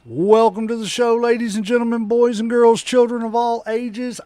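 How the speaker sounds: loud ringmaster call, every phrase punched. Acted. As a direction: announce to the whole arena. Ringmaster-style show opening.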